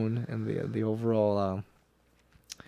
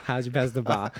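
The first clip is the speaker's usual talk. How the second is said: light Boston accent imitation; Boston accent, "bar" with no r. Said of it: "Is that your Boston accent?".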